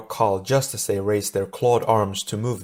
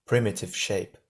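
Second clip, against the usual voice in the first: ordinary delivery, nothing marked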